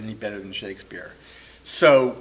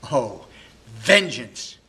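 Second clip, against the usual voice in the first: Posthumus crying vengeance; 6 dB louder, much slower than usual. Acted. Betrayed husband alone, calling for revenge in an angry cry. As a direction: hurl vengeance out in fury. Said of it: "heartbroken and very angry".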